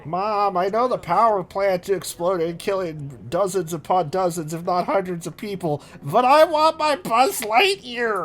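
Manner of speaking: put-on attention-seeking child. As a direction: beg like a spoiled little child. Kids begging mom for toys.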